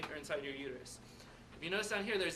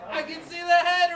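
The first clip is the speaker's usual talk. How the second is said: excited; 11 semitones higher, 15 dB louder than usual. Acted. Teacher acting out seeing the head.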